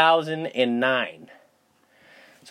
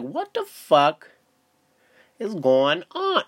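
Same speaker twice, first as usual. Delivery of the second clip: slow baffled disbelief; 3 semitones higher, much slower than usual. Retold or imitated. Retold confusion, slow, drawn out.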